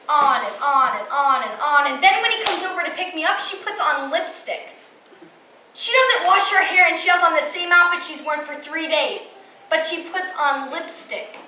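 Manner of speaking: put-on worked-up teenage rant, loud and pushed; exasperated venting. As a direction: vent indignantly, voice climbing on the complaints. Venting monologue about her mom.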